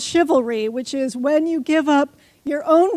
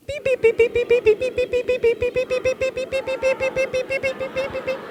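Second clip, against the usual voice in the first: much higher, rapid robotic beeping; alien beeps; 9 semitones higher than usual. Shown as a demonstration. Speaker trying to get the whole room to be aliens, high beeps. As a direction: chirp like a tiny alien, over and over. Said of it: "we're gonna practice being aliens".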